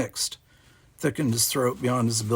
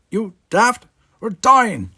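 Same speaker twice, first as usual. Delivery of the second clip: desperate shout; 11 semitones higher, 10 dB louder, slower than usual. Acted. Desperate plea in the sim.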